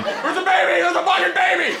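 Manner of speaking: high-pitched frantic scream; shrieking in a sleep terror, very high. Retold or imitated. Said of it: "he is screaming over and over".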